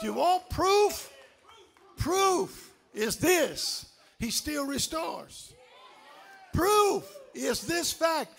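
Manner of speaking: high, slow, stretched declarations; fervent preaching. Real, not acted. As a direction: let each phrase ring out from the pulpit.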